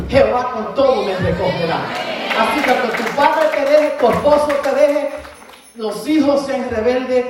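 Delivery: loud, rising preaching climax; preaching at full force. Real, not acted. Preacher urging the congregation to keep walking, loud and fervent. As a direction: lift the church with each phrase.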